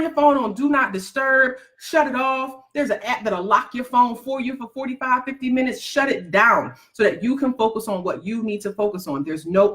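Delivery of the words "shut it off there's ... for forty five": ordinary talk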